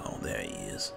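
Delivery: normal talking voice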